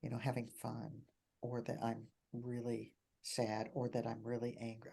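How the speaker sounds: low, subdued, held-back delivery; restrained professional mask. Retold or imitated. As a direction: imitate someone keeping all feeling in, softly and low.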